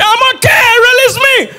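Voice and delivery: high shouted plea; mock shouting patient; imitated shout. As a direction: yell it like a man begging to be let out. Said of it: "He was shouting".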